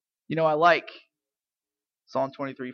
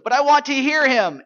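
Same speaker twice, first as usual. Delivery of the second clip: put-on four-year-old child; 10 semitones higher, 12 dB louder than usual. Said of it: "his little four-year-old said".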